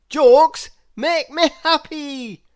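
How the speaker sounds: high, loud delighted cry; bubbling over with glee; Mister Happy thrilled at the news; cheerful Mister Happy. Acted.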